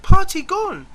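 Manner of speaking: squeaky imitation of the French lad; French boy. French boy waking him, mock child voice. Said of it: "woke me and said".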